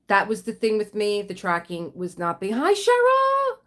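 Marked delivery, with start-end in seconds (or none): 2.5-3.6 excited greeting. Real, not acted. Greeting viewer on livestream.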